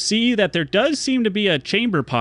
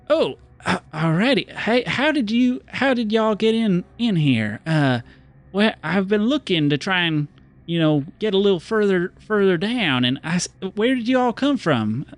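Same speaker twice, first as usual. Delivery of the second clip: friendly stranger; 5 semitones higher than usual. Acted. GM voicing the friendly stranger to the Lumpen, high folksy howdy. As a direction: greet them like a smiling cowboy.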